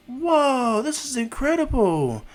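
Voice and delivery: high, drawn-out loud amazement; awed students. Retold or imitated. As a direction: marvel aloud, stretching it out high. Students first see the building.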